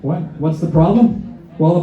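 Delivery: ordinary talk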